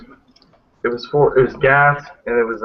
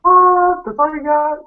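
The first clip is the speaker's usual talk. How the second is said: incredulous; 5 dB louder, slower than usual. Real, not acted. Mocking a weak answer.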